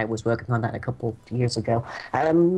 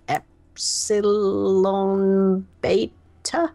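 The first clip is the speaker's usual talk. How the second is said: slow deliberate naming; 7 semitones higher, 4 dB louder, much slower than usual. Acted. GM naming a corridor.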